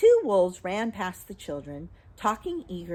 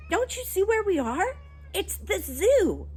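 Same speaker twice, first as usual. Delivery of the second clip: excited discovery; 13 semitones higher, 4 dB louder than usual. A narrator's reading. Jane showing her brother the zoo, excited. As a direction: reveal it with rising excitement.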